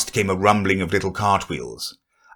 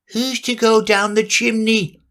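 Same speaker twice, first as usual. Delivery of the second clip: high character voice; 13 semitones higher than usual. Acted. Rabbit asking.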